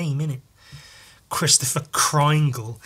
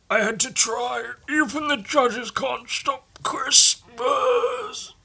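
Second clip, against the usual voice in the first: higher whispered Santa line; mock Santa voice; 8 semitones higher, slower than usual. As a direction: breathe it out as a defeated Santa.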